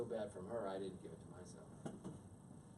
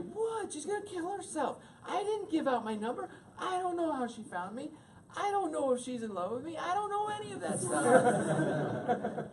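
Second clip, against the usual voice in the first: much higher, louder frantic excuses; panicked defensive rant; 5 dB louder than usual. Retold or imitated. Defending himself to his wife, high frantic rant. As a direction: protest your innocence frantically.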